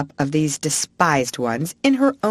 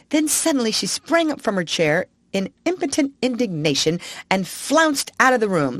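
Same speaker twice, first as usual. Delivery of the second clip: ordinary delivery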